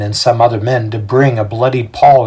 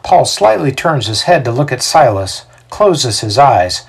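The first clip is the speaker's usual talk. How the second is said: plain reading voice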